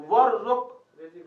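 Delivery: slow, emphatic teacher's prompt; very slow recitation. Shown as a demonstration. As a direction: hold the word out for the class to follow. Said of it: "फिर क्या कहा?".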